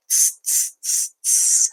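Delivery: whispered tss of teasing monkeys; monkey tsk. Acted. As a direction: hiss tss back like cheeky monkeys. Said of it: "back at him and said".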